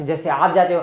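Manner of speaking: ordinary delivery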